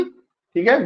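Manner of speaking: ordinary delivery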